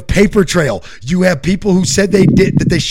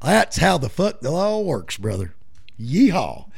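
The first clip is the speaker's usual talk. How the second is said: muttered yeehaw; 7 dB quieter than usual. Pretend. Muttered cowboy sign-off.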